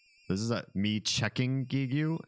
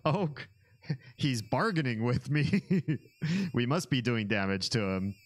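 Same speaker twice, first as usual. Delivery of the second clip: ordinary delivery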